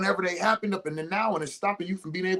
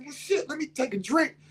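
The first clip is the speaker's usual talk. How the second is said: client voiced; 11 semitones higher than usual. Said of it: "so I said".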